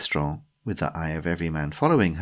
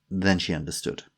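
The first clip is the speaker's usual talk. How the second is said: ordinary delivery, nothing marked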